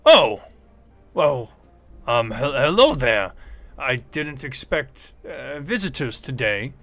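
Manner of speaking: put-on startled old gnome man, loud surprised greeting; a flustered old tinkerer caught off guard; old gnome scientist, startled. Old gnome greets unexpected adventurers, startled and loud. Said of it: "looks at you all and goes".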